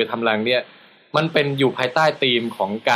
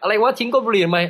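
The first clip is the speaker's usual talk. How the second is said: higher-pitched playful telling-off; imitated scolder, pitched up; mock scolding; 8 semitones higher than usual. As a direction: act out someone indignantly challenging you. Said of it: "ถ้าเกิดใครมาด่าบอก".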